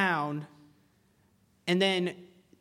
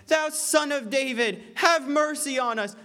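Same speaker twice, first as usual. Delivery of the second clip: crying out for mercy; 7 semitones higher, 3 dB louder than usual. Retold or imitated. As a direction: cry out to him as he walks. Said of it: "screaming and shouting to God".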